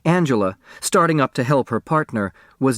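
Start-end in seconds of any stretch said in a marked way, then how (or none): none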